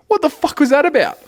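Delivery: high, loud snail punchline; indignant snail. Acted. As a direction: blurt it as an outraged little snail. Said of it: "the snail goes".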